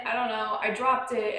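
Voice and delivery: ordinary talk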